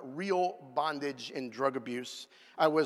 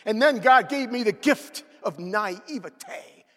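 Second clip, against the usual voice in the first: ironic raised voice; 6 semitones higher, 8 dB louder than usual. Real, not acted. Preacher raises voice for irony.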